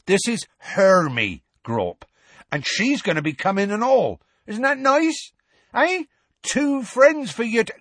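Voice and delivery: high-pitched, sing-out introduction; Hagrid coaxing. A narrator's reading. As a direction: talk it up to a big, simple giant. Hagrid coaxes giant to meet friends.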